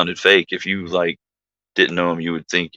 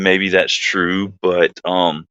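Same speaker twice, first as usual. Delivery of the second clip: ordinary talk, nothing marked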